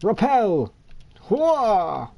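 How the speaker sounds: high commanding yell; villain command shout. Acted. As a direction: bark out the power move.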